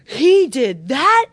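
loud, high, slow indignant gasp; he did that, outraged; shocked disbelief. Pretend. Spouse's shocked reaction.

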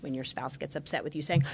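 ordinary talk, nothing marked

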